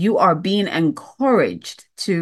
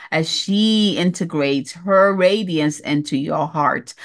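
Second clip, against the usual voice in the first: ordinary talk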